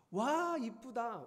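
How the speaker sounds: high, slow, drawn-out gush of delight; family cooing over something pretty. Retold or imitated. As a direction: coo over it like the whole family does.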